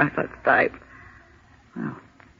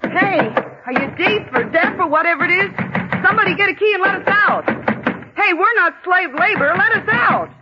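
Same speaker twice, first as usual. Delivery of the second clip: yelling to wake the dead; 13 semitones higher than usual. Acted. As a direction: bellow at the deaf building. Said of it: "I'll wake the dead".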